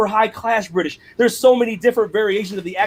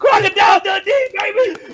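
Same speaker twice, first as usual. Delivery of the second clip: high, hyped-up cheer; excited shout; 11 semitones higher than usual. Real, not acted. Host cheering a friend's film impression, high excited shout. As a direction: whoop it out with delight.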